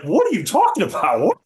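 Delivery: high, loud baffled cry; baffled umpire. Pretend. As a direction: pitch it up high, thrown completely off. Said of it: "It's gonna be like".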